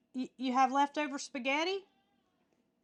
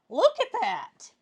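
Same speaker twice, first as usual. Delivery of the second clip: excited; 5 semitones higher, 8 dB louder than usual. Real, not acted. Reacts to freeze-dried cheesecake.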